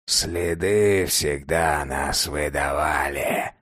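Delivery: put-on low, soft, slow weary old man's voice; old vampire sighs to the boy, low and slow; sighing. A narrator's reading. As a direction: sigh it out, resigned to old truth. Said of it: "вздохнул старик".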